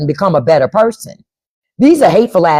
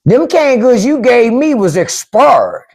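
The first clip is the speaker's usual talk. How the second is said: slow, higher Southern drawl complaint; Southern accent; 6 semitones higher, slower than usual. Retold or imitated. Repeating the neighbor's complaint in a Southern accent. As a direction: drawl it like a country grandpa. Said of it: "that's how they say it down south".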